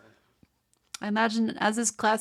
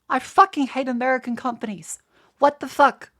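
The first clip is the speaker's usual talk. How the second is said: louder, higher furious outburst; real anger; 3 semitones higher, 6 dB louder than usual. Real, not acted. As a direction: blow up at the price. Ranting about American tax pricing.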